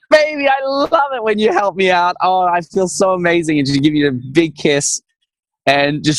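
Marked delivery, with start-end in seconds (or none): 0.1-3.6 higher, delighted sweet-talk; shower him with praise; gushing partner. Retold or imitated. Man imitating his partner praising him, higher voice. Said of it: "She's like".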